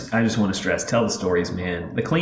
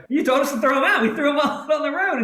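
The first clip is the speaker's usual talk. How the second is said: put-on high, giggly little-boy voice; boy explaining to grandpa that the fish are gone, giggling; kid's protest; 4 dB louder than usual. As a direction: answer innocently like a laughing child. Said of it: "I go".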